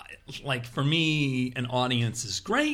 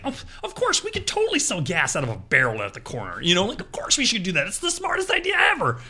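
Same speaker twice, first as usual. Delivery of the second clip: absurd know-it-all; 8 semitones higher than usual. Retold or imitated. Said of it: "one person's like".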